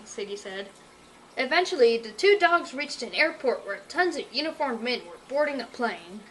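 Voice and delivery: plain reading voice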